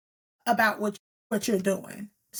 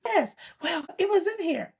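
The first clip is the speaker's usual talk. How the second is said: stepdaughter voice; 7 semitones higher than usual. Retold or imitated. Stepdaughter's curt answers.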